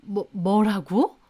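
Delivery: slow, stammering shocked question; mother-in-law, stung by an insult, stammers in disbelief; stunned disbelief. A narrator's reading. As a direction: let it drop out slowly in shock. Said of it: "얼굴 새파래지셔서 저와 형님을 노려보시더라고요".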